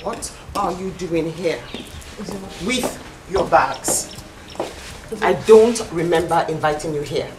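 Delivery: loud, measured indignation; cold confrontation. Acted. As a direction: question an unwelcome guest word by word. Confronting unwelcome visitor with bags.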